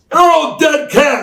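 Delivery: long, drawn-out high shout; triumphant catchphrase yell. Retold or imitated. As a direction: bellow it slowly at full volume, way up high. Finally yells the gimmick's full name.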